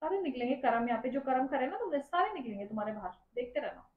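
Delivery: soft, low warning of payback; ominous, hushed and low; quiet threat. Real, not acted. Wife warns of payback.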